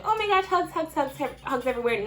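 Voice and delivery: high, put-on squeal easing down; mock-excited hug lover; mock gushing. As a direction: imitate a gushy hugger, starting high. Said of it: "not the person to be like".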